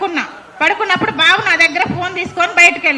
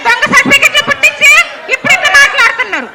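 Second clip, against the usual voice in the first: raised, higher, louder voice hurling the charge; angry accusation; 7 semitones higher, 6 dB louder than usual. Real, not acted. Confronting them over fake certificates, angry raised voice. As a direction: call them out hard and loud.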